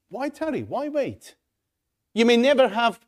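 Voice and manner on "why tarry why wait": pleading. Real, not acted. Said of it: "final plea".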